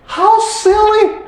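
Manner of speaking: high, slow sneer; drawn-out scoffing tone; mocking Noah's boat, pitched high; mocking scorn. Mocking Noah.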